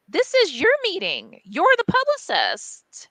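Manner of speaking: indignant, high-pitched retort; retold outrage, pitch jumping up in disbelief; losing it. Publisher telling the publicist it is her meeting, indignant. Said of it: "I lost my shit".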